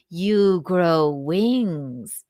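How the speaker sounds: slow, drawn-out punchline; lightly imitated dad, deadpan. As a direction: say it dead slow like a dad joke. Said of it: "they laughed hysterically".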